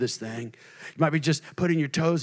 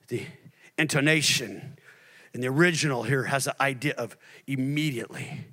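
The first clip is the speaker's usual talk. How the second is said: ordinary delivery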